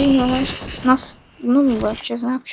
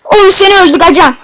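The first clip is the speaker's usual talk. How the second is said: loud, higher playful threat at an enemy; mock threat; 6 semitones higher, 6 dB louder than usual. Real, not acted. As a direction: yell it at the player who got you. Yelling at game enemy.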